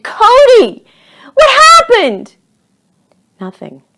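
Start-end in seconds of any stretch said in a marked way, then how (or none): 0.0-2.4 high, very loud scream down to someone. A narrator's reading. Charlie screams after fallen Cody, high and loud. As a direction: shriek it, drawing out the words. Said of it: "Charlie screamed".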